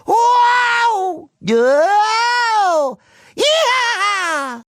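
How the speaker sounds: high, drawn-out cartoon whoops; thrilled whooping. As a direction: whoop like riding wildly.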